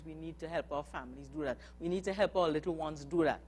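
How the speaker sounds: normal talking voice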